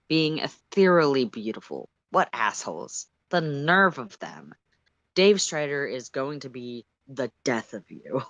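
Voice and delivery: usual speaking voice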